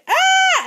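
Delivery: very high, loud short shriek; sudden high-pitched scream. A narrator's reading. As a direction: shriek as if falling in. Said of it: "there was a shriek".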